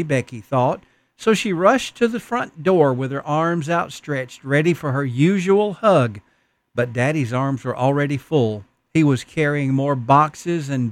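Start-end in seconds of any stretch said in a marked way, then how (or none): none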